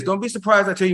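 ordinary talk

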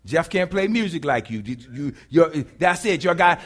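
loud, animated back-and-forth preaching; full-force preaching. Real, not acted. Preacher acting out complaint to God and God's reply, full-force preaching. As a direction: drive it home with forceful, punched phrases.

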